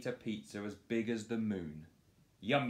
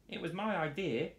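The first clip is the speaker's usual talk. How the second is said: much higher, pleased; proud piggy voice; 10 semitones higher than usual. Acted. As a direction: boast it. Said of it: "said Doris".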